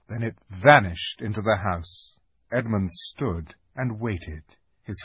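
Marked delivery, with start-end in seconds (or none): none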